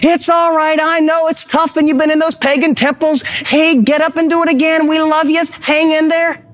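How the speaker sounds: high-pitched cheerleading dad voice; encouraging dad. Acted. Dad cheering on a son who fell down, high-pitched encouragement. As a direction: urge him back up, pitched high like a rooting dad. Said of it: "We were encouraging. We were your cheerleaders. We were the dad who said".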